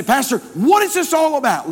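high, loud acted outcry; loud put-on voice of a baffled member; questioning congregant. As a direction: throw the question out at a high pitch.

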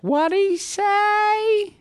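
very high, slow screechy joke voice; hard-of-hearing wife. Acted. Wife asking husband in the joke, hard-of-hearing screech. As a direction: screech it out, stretching each word. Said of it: "the wife is hard of hearing".

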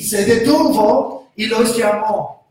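usual speaking voice

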